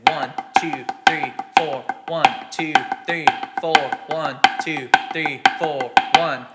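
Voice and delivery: loud, evenly punched count. Shown as a demonstration. Drum teacher counting downbeats for students, loud and rhythmic. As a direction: call out each downbeat firmly, in strict rhythm. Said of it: "you could just say it out loud".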